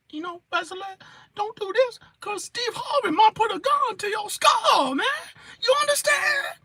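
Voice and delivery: high, frantic warning; mock threat. Warning Wesley about Steve Harvey, high pleading.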